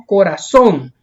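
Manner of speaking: corazón with a strong final syllable; last-syllable stress. Shown as a demonstration. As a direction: land hard on the end of corazón. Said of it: "Lleva la mayor fuerza de voz".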